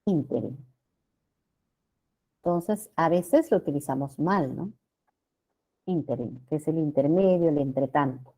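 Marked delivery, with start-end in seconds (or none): 0.0-0.7 Ínterin falling from a stressed ÍN, low and soft; Ínterin said as ÍN-te-rin; stressed first syllable. Shown as a demonstration. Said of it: "Acá está la sílaba tónica". 5.8-6.5 Ínterin low and soft, stressed on the first syllable; Ínterin said as ÍN-te-rin; correct stress. Shown as a demonstration. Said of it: "es ínterin".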